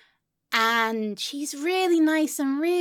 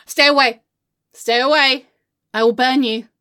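loud, forceful threat without raised pitch; menacing warning; 4 semitones lower, 7 dB louder than usual. Acted. Backing away from conspiring friend with blowtorch, menacing warning. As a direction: ward them off loudly.

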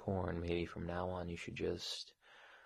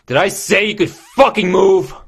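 high, very loud scolding question; angry shout; 12 semitones higher, 24 dB louder than usual. Acted. Boss scolding employee for moving, furious shout. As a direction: snap loudly at disobedience.

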